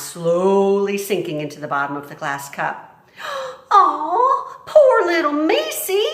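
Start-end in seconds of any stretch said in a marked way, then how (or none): 3.2-3.7 high sharp gasp; surprised gasp. Acted. Mig sees mouse. 3.7-6.0 high, loud pitying coo; Mig cooing. Acted. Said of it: "she said".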